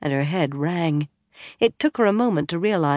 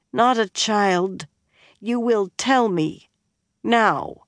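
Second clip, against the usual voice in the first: slow, higher, tipsy command; drunk young woman; 5 semitones higher, slower than usual. A narrator's reading. Elaine ordering the bard to answer, slow and tipsy. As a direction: drag it out, stubborn and tipsy.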